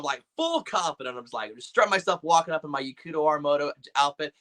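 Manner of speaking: normal talking voice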